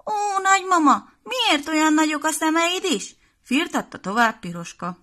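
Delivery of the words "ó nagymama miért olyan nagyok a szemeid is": put-on high, loud child's puzzled question; a little girl amazed at grandma's ears; wondering little girl. Piroska questions disguised wolf.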